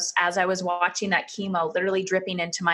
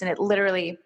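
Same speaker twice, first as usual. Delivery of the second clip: ordinary delivery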